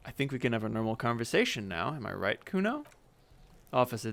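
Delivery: ordinary talk, nothing marked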